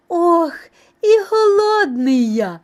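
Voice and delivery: high, loud little mouse groaning with hunger; hungry moaning. A narrator's reading. Mouse at the table, moaning with hunger.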